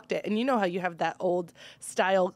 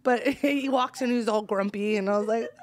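ordinary talk, nothing marked

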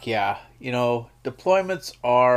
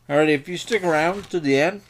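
normal talking voice

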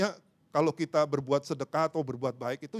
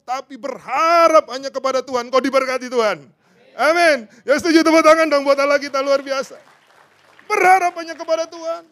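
higher, louder, building to a cry; loud revival preacher; fiery preaching; 10 semitones higher, 10 dB louder than usual. Acted. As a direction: drive the call home with passion.

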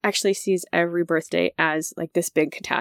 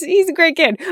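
ordinary talk

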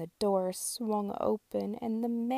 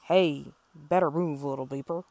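loud, low street sweeper holler; low, loud hey; 5 semitones lower, 7 dB louder than usual. Acted.

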